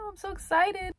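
high, eager and excited; bubbling over with excitement. Real, not acted. Announcing trip to flea market.

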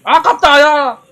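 loud, high frustrated yell; frustrated shout. Real, not acted. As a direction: yell at the near miss. Nearly got the kill.

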